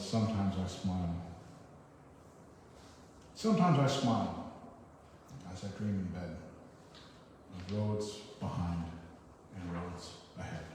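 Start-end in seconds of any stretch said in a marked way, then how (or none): 3.3-4.5 first line of the poem, louder, falling on "Sometimes"; poem reading. Acted. Reads the poem title line. 5.5-10.7 poem reading. Acted. Continues the poem.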